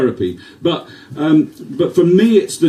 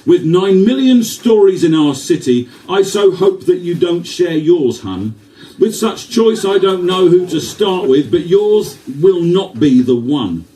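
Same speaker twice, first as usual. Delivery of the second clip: poem recital; 4 semitones higher than usual. Acted.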